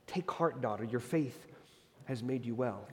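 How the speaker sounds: quiet comforting words to a healed daughter; kind and gentle. Retold or imitated. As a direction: speak it gently to a frightened woman. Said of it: "kindly says".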